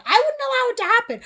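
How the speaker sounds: high, loud outraged rant; heated rant. Real, not acted. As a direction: build to shrill outrage. Ranting, acting asking a name.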